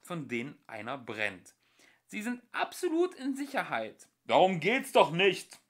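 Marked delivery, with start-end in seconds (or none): 2.0-4.1 friendly stewardess. Acted. Ship's voice calming the travellers like a stewardess. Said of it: "wie die einer Stewardess". 4.2-5.6 loud, high furious shriek; angry screech. Acted. Ford screeching at the ship's voice, furious. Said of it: "kreischte Ford wütend".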